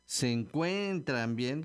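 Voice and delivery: slow se encuentran bien, leaning on CUEN and BIEN; exaggerated stressed syllables. Shown as a demonstration. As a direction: stretch each E of encuentran and bien. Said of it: "con acento en la E".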